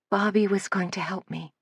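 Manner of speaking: a soft, confessional admission; confessing softly. A narrator's reading. Lydia confessing to Suzanne, soft and hushed. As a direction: admit it quietly like a confession. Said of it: "almost as though she were confessing".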